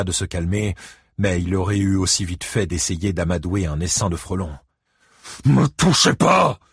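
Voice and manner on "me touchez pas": slow, high, loud roar. A narrator's reading. Ike fighting off men who hold him, roaring. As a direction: roar each word out. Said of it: "rugit Ike".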